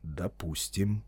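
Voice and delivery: slow, quiet, low concession; measured, composed wizard; calm. A narrator's reading. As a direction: say it softly, unruffled. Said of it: "спокойно произнёс маг".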